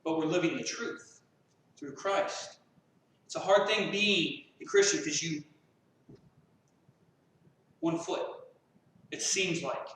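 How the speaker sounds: usual speaking voice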